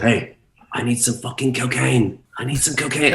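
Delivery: put-on a failed, half-hearted German accent; bad German accent. Imitating the German DJ on a bender, bad German accent. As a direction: try a German accent and miss. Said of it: "I can't do a German accent".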